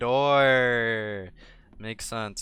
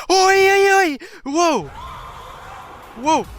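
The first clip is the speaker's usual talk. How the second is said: high, loud panicked yelps; sudden burst of shrieked exclamations; startled yelling; 10 dB louder than usual. Real, not acted. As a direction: yelp out in real alarm. Startled by bird in game.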